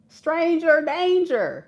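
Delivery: slow warning. Shown as a demonstration.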